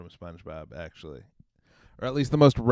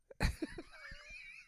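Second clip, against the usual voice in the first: high shrieking laugh; 14 dB quieter than usual. Real, not acted.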